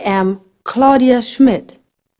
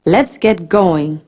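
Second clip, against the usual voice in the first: ordinary talk, nothing marked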